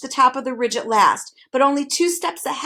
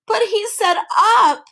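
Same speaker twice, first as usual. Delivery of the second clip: slow, much higher, louder protest; bitter complaint; 11 semitones higher, 7 dB louder than usual. A narrator's reading. Milo complaining from the pit, bitter and loud. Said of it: "Milo complained bitterly".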